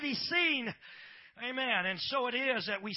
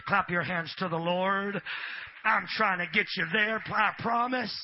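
ordinary delivery

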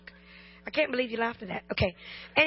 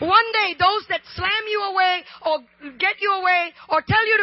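loud, high, shouting sermon climax; fiery preacher crying out to the congregation; full-force preaching; 8 semitones higher, 11 dB louder than usual. Acted. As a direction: preach it out at the top of your voice, pleading.

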